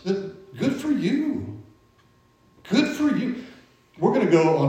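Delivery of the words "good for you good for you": lifted, drawn-out good for you; sing-song cheer for someone else's win; playful high-pitched good for you; high mock congratulations. Shown as a demonstration. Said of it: "Don't be jealous".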